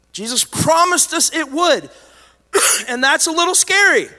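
high, loud, driving sermon delivery; full-force preaching. Real, not acted. Pastor rousing his congregation about persecution, fervent preaching. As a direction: preach it hard to the back row.

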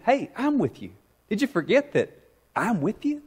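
Jesus gently reminding the discouraged listener; gentle reassurance. Pretend.

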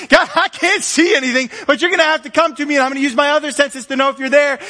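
high, fast, pleading cry; blind man's plea. Acted. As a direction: cry out to God, begging.

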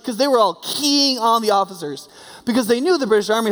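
usual speaking voice

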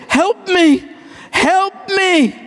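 put-on high childlike "Help me, help me." pleading; child crying for help. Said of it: "you hear your child say".

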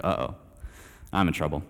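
low, hushed uh-oh; quiet mock dread. Stranger runs back up to him, quiet mock dread. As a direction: drop low and soft as trouble approaches.

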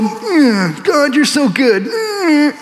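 high, mocking voice with a sneering laugh; hammed-up bitter prophet, gloating over the city; sarcastic pouting Jonah. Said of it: "arms folded".

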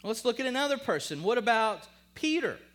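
loud, high, emphatic sermon; full-force preaching. Real, not acted. Preacher answering his own questions to the congregation, forceful. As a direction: drive each answer home to the crowd.

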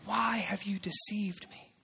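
breathy whispered accusation, slow; hushed whisper. Acted. Preacher whispering Jacob's hurt question. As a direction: lean in and whisper it.

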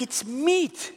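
high, startled realisation; shocked discovery. Retold or imitated. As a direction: gasp it out in disbelief. Discovering meat in ravioli.

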